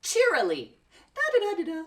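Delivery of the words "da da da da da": high, happy little da-da tune; cheery singsong. Shown as a demonstration. Teacher shows cheerily with high happy singsong. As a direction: bounce it out like a happy hum. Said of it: "means very happy".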